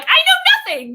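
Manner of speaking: high, loud comic outburst; exclaiming. Acted. Guest acts out her humbled self to the host, exclaiming loudly. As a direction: throw it out as a joyful confession.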